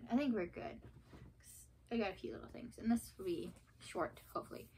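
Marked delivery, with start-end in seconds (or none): none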